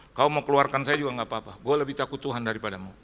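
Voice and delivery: mildly heated retold defiance; a touch of quarrel in the voice; acted arguing. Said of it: "kita bertengkar setengah jam".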